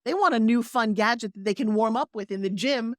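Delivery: ordinary talk, nothing marked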